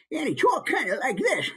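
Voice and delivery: put-on high, cackling old-timer; old man voice. Shown as a demonstration. Teacher impersonating an old TV actor, cackling old man voice. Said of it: "an example of an old man voice".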